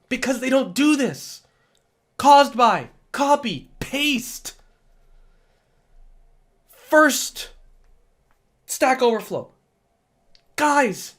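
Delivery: worked-up, high-pitched exasperation; hyped-up, emphatic frustration; exasperated rant. Real, not acted. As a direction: rant at the class, punching each key step. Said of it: "if you like my angry rants".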